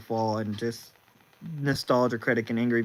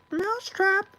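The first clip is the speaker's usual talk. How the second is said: much higher cartoon mouse, aghast and outraged. Steamboat Willie upset with viewers over horror movie, much higher cartoon mouse voice.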